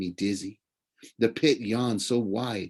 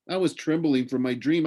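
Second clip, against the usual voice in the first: plain reading voice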